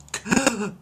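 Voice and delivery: high shocked gasp; sharp gasp. Acted. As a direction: gasp as you hit the water. Stewie pushed into the water.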